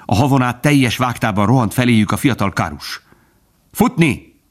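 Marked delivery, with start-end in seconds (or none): 3.7-4.4 high urgent shout; a high alarmed yell. A narrator's reading. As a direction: yell the warning mid-run. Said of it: "kiáltotta".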